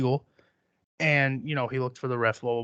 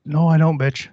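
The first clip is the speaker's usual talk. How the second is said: defiant retort; 4 semitones higher, 7 dB louder than usual. Retold or imitated.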